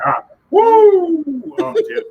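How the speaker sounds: high, loud joyful whoop. Real, not acted. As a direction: let out a real whoop of joy. Exuberant outburst.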